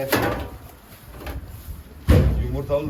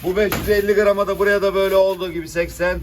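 auctioneer; 6 semitones higher, 8 dB louder than usual. Acted. Fish auction calling prices.

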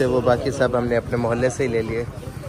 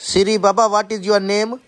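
high, raised question to a voice assistant; loud opening, high-pitched query; calling to Siri; 7 semitones higher, 4 dB louder, much slower than usual. Real, not acted. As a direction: call it out for a machine to catch. Asking the voice assistant.

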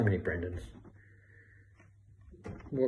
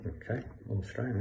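much softer, talking to oneself; pondering aloud; 9 dB quieter than usual. Acted. Trying to place a man from his credits, quietly pondering. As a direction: repeat it quietly as you think it over. Repeats a credit to himself, puzzling.

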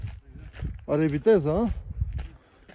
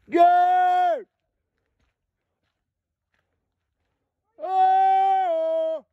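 high, sustained holler; calling out; 9 semitones higher than usual. Real, not acted. Hiker calling out to a companion, long holler. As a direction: send a long call far off.

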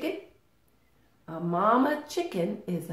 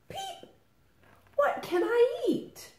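very high tiny chick voice; little chick. Acted. First little chick asking Mother Hen for food, tiny high voice. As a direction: squeak it like a baby chick. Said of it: "Said the first little chick".

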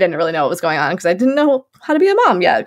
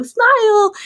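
very high, singsong coo; baby-talk coaxing; 13 semitones higher than usual. Retold or imitated. Mother with phone up asks baby to smile, high baby-talk. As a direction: coax a baby in a high little voice.

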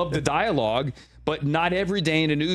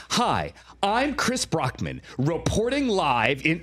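mock field reporter; 4 semitones higher, slower than usual. Host mocking a field anchor on TV, exaggerated newscaster. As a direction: overblown TV anchor delivery, slow and raised. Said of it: "no one talks like that on T V".

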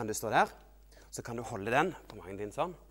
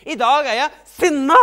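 high, very loud mock-angry shout; angry outburst; 11 semitones higher, 16 dB louder than usual. Child telling Jesus of anger, loud acted outburst. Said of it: "fortell han det".

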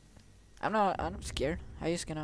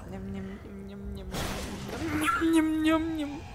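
repeated nyam-nyam noises jumping higher and louder; silly nyam chanting; 4 semitones higher, 6 dB louder than usual. Pretend. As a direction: chant nervous nonsense munching sounds. Nonsense chanting while hiding in corner.

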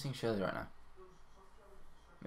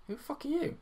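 mock high voice; 3 dB quieter than usual.

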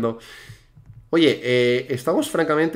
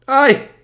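loud, high "ay"; sharp cry at a near miss; startled yelp; 10 semitones higher, 8 dB louder than usual. Real, not acted. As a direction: yelp out in sudden alarm. Player slips during the jumps.

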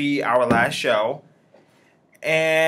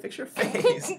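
laughing; 5 dB quieter, slower than usual. Real, not acted. High laughing repeat.

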